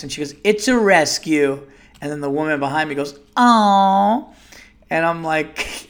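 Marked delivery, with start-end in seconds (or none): 0.4-1.6 higher, louder, drawn-out mock boast; over-the-top cashier. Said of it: "this girl was so over the top". 3.4-4.2 high, loud, long-drawn coo; mock gushing. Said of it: "this girl was so over the top".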